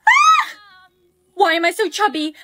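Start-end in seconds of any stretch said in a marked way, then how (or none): 0.1-0.6 very high, loud shriek of shock; panicked shriek; horrified scream. Acted. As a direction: scream like you just saw the mirror. Sudden shock at weight gain.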